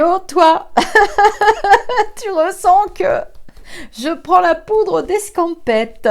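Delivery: high, loud, laughing retort; laughing reply. Retold or imitated. Answering her sister, teasing: high laughing reply.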